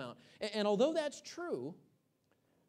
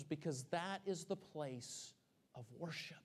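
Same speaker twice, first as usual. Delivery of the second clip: hushed whisper; 4 semitones lower, 10 dB quieter than usual. Acted. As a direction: sink to a whisper on "of worship". Preacher drops to hushed climax.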